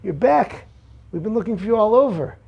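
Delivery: a father thrilled his son is home; overjoyed father. Acted. Father welcomes lost son home, overjoyed and quick. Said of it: "his father's overjoyed".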